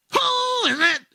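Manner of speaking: very high parrot squawk. Acted. Bird at the door squawking at the plumber, very high. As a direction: screech it like a parrot. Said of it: "The bird says".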